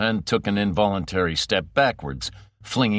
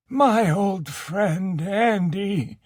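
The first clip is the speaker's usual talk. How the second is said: put-on high, slow, frail old man's voice; old faint quavering; 11 semitones higher, much slower than usual. Frail Ocken greeting his old friend, faint quaver. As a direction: quaver like a broken old man. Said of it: "a voice old and faint and quavering".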